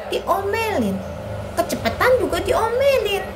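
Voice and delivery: sing-song list of grievances; complaining child Ani. Acted. Ani lists what she gets scolded for, aggrieved. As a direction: lift and drop each complaint, childlike.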